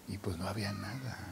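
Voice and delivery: whispered, very soft; almost voiceless and hushed; hushed admission. Real, not acted. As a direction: drop to a whisper, confiding the hardship. Recalling having nothing at home.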